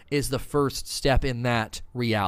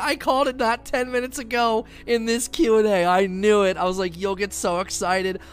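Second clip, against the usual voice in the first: excited gloating; 6 semitones higher than usual. Real, not acted. Streamer bragging to chat he predicted Eris Morn, excited. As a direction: crow about calling it.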